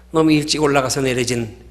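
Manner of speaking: usual speaking voice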